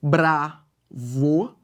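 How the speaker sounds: "Bravo, sou for" split into separate slow syllables; "Bravo, sou for" with a pause after every syllable; syllable-by-syllable scansion. Shown as a demonstration. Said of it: "Eu separo metricamente".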